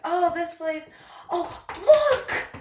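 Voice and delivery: high, loud burst of delight; excited discovery. Retold or imitated. Model spots wire while walking with photographer, excited. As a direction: point it out with mock-giddy excitement.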